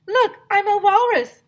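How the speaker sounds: high, loud walrus boast; high, loud show-off announcement. Acted. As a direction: announce it proudly with a squeaky voice. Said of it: "I announced".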